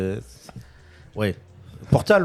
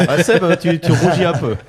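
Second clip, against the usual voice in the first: laughing; 5 semitones higher, 6 dB louder than usual. Real, not acted. Teasing friend about red face.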